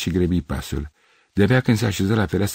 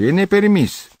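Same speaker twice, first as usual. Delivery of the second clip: higher and louder indignant cry; raised voice; 13 semitones higher, 5 dB louder than usual. A narrator's reading. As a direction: raise your voice in outrage. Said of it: "exclamă ridicând glasul".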